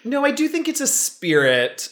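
high giggly laughing voice; laughing while talking. Real, not acted. Laughing at the Manischewitz joke.

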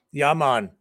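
put-on "yeah, man" in a light Jamaican accent. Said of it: "That's my Jamaican guy".

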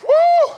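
very high, loud sustained "woo"; exultant whoop. Real, not acted. Preacher rejoicing over destiny opening tonight, exultant whoop. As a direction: whoop at full voice.

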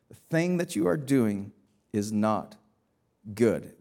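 "is not good" spaced out, low and soft. Real, not acted. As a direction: pause before "good" and let it drop. Repeats verse slowly for weight.

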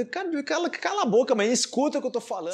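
high-pitched mock exasperation; exasperated outburst. Acted retort to someone correcting his words, exasperated.

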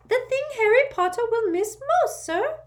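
urgent little servant squeaking; an anxious house elf waking his master; squeaky house elf. A narrator's reading. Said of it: "squeaked Dobby".

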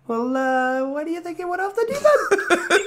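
high, cracking with laughter; laughing high voice. Pretend. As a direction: squeak through giggles.

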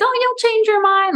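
very high, singsong mock-mom voice; high-pitched parental dismissal; dismissive mom. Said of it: "And my mom".

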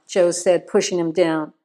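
ordinary delivery

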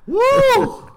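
loud high cheer; excited whoop. Real, not acted. Cheering for Aloy.

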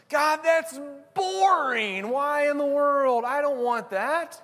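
very high, drawn-out mock complaint; mock childish whine. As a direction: whine like a child refusing what's offered. Acts us whining childishly at God.

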